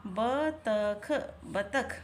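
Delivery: बतख drawn out very slowly while writing; slow dictation. Shown as a demonstration. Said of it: "मैं आपके लिए लिख रही हूँ".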